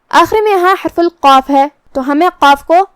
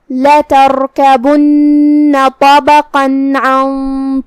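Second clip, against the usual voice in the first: slow melodic Quran chant; tajweed recitation; 3 dB louder, much slower than usual. Acted. Teacher's full surah recitation for listeners, slow chant. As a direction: recite each verse long and measured. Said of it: "اب آپ سورۃ الانشقاق کی تلاوت تجوید کے ساتھ سماعت فرمائیں".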